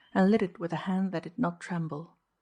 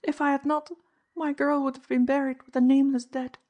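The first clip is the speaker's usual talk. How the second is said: higher pleading woman's voice; anxious housekeeper; 8 semitones higher, 5 dB louder than usual. A narrator's reading. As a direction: justify it, higher and desperate. Mrs Kinney explains.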